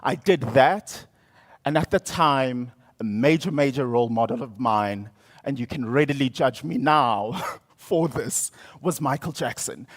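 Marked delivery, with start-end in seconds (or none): none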